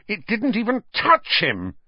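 high-pitched angry protest; shaking with anger. A narrator's reading. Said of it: "his voice shaking with anger".

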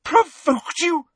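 high, drawn-out outraged shout; incredulous shout. A narrator's reading. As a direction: throw it back in furious disbelief. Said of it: "shouted Professor McGonagall".

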